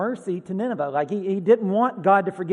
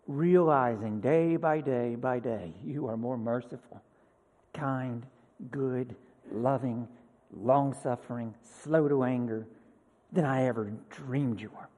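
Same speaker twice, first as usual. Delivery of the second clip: tender prayer; 6 semitones lower, 4 dB quieter than usual. Real, not acted. Preacher turning to God in hushed prayer. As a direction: hush it down into a prayer.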